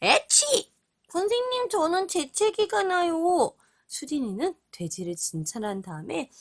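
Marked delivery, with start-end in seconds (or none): 0.0-3.5 high squeaky sneezing pig; sneezing piggy voice. Acted. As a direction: sneeze then whine like a piglet. (재채기하는 돼지 목소리)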